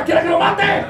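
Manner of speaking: high-pitched comic threat; exasperated threat. Acted. Envoy losing patience with the stalling boy, exasperated threat.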